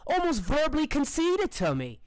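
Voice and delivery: high, put-on fighter's voice; boastful Annabelle. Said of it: "she says".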